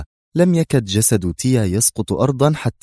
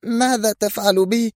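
cry of protest; 12 semitones higher than usual. A narrator's reading. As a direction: cry out as breath and strength fail. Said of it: "فصرخت". (صرخة احتجاج بطبقة عالية)

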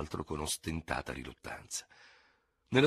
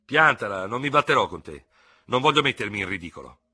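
louder, firm brush-off; annoyed refusal; 4 semitones higher, 8 dB louder than usual. A narrator's reading. Dubert refuses to fight his challenger, annoyed. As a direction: tell him to drop it, fed up.

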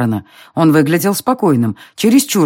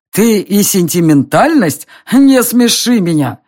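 slow, louder mocking scoff; scornful outburst; 5 semitones higher, 4 dB louder, slower than usual. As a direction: scoff at him, louder and higher. Emperor scoffs at Darren.

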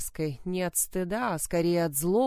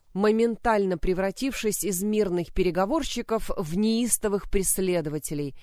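ordinary delivery, nothing marked

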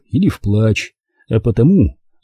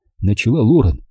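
plain reading voice

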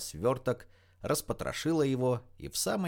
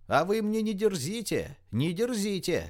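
much higher, outraged squeal; shrieking mother; 10 semitones higher, 4 dB louder than usual. A narrator's reading. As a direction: screech a scolding, without full volume. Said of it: "визжала мать загулявшей девушки".

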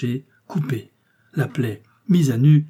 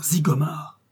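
stunned echo; 4 semitones higher, slower than usual. A narrator's reading. A second onlooker echoes the name, stunned. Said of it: "s'écria-t-on".

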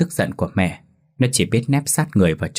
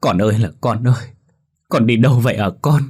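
wailing; 3 semitones higher, 4 dB louder than usual. A narrator's reading. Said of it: "cô Nụ liền lăn đùng ra bật khóc".

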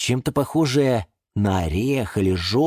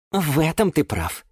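mildly patient concession; patient sigh; 8 semitones higher than usual. Acted. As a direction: concede with patience, a little higher. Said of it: "терпеливо вздохнул Юра". (терпеливо, со вздохом)

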